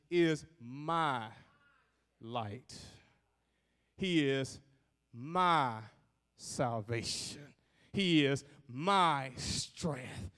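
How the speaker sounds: slow, spaced words, MY held long each time; personal stress on MY. Shown as a demonstration. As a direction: set MY apart with pauses. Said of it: "declaration very personal".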